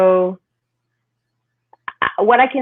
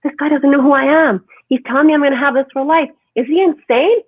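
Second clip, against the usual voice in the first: higher, louder and fast, mock outrage; retold indignation; 6 semitones higher, 5 dB louder than usual. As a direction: act out disbelief at the doctor. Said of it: "I'm like".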